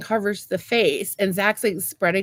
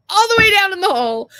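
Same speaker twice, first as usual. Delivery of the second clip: higher, louder burst of disbelief; exclaiming; 11 semitones higher, 7 dB louder than usual. Real, not acted. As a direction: blurt it out in comic dismay. Boot sinking into the hole.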